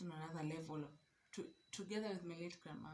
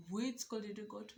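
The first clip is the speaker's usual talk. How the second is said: incredulous; 4 semitones higher, 6 dB louder than usual. Real, not acted. Repeating the mocking question louder.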